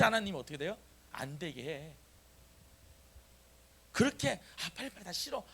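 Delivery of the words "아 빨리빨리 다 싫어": high-pitched imitated grumbling; mock whining complaint. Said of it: "이러잖아". (투덜대는 흉내, 높은 목소리)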